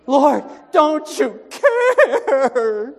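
high, slow mock wail; panicky disciples.

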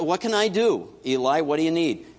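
lightly voiced keen young servant; eager Samuel.

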